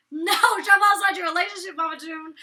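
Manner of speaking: a loud, high correction to Mama June; loud retort. Retold or imitated. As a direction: fire it back loud and high. Quoting judge's retort.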